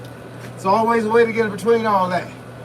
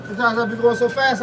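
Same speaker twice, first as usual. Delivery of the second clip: ordinary talk, nothing marked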